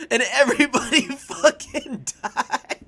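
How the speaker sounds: laughing through the words; can't keep it together, laughing. Real, not acted. Laughing while telling the stream.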